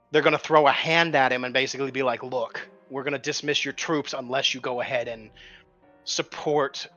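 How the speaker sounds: ordinary delivery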